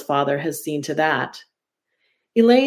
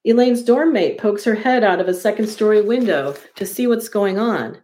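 plain reading voice

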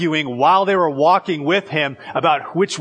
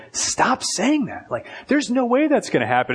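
Peter rebuking; 9 semitones higher than usual. Retold or imitated. Peter pulling Jesus aside, high rebuke.

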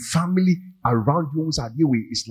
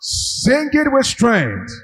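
booming motivational speech; 12 semitones higher, 3 dB louder, much slower than usual. Acted. Urges singing.

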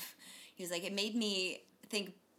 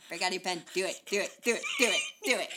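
pushy goose; 8 dB louder than usual. Retold or imitated. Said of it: "The goose was just like".

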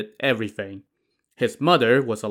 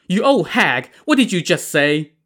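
higher, louder, furious retort; angry cursing; 6 semitones higher, 4 dB louder than usual. A narrator's reading. Spoiled performer confronting the old mother, furious cursing. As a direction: snap at the old woman with venom. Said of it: "stomped over and cursed".

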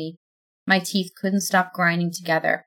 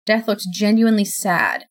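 plain reading voice

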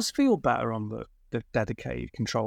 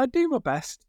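high playful; 12 semitones higher than usual. Real, not acted. Self-mocking repeated phrase.

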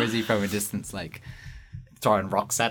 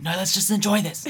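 pleading wife; 11 semitones higher, 5 dB louder than usual. Retold or imitated. Imagined wife pleading on trip.

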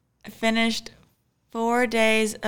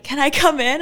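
panicked plea; 6 semitones higher, 7 dB louder than usual. Retold or imitated. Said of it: "kind of panicked".

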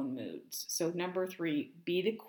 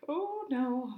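high-pitched mock dread; light, high "uh-oh" reaction; a little worried; 8 semitones higher than usual. Woman finding reply note on car, mildly worried. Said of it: "a little worried to read the note".

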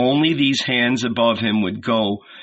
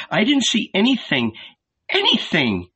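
higher, mildly plaintive repeat; despairing murmur; 9 semitones higher than usual. A narrator's reading. Mary insists to Salome she saw nothing, despairing. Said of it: "she murmured".

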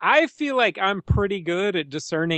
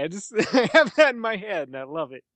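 ordinary talk, nothing marked

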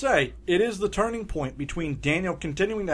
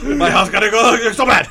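hammed-up loud complaint in a put-on voice; mock grumbling master; 4 semitones higher, 8 dB louder than usual. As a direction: imitate an exasperated teacher, loud and comic.

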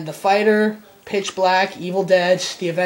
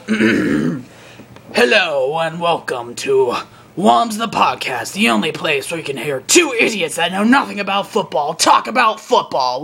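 sports announcer voice; 3 dB louder than usual. Acted. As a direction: welcome the crowd like a sportscaster. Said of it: "I'm gonna do it in my sports announcer voice".

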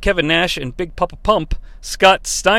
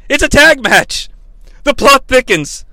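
high, mock-dramatic announcing; announcer; 6 semitones higher, 3 dB louder than usual. Host resumes announcing duties, raised dramatic voice. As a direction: proclaim it like a big twist. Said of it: "back to my announcing duties".

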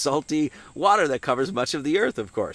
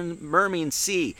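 ordinary delivery

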